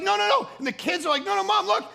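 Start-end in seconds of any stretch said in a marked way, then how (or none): 0.0-0.5 high, louder, insistent objection; acted frantic correction; urgent protest. Husband protests when wife calls it silliness, frantic. 1.2-1.8 put-on high, urgent child-like protest; pleading kids. Kids plead with mom to look, urgently.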